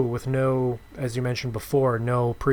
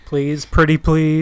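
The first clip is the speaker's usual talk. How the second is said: louder, slow, playful pleading; mock begging; 3 semitones higher, 8 dB louder, slower than usual. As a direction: beg sweetly like a kid asking for candy. Mock begging for sign-ups.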